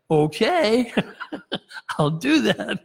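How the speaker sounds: sarcastic. Retold or imitated. Micaiah answering the messenger's advice with sarcastic agreement. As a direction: play along with a smirk. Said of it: "it's gonna come out very sarcastic".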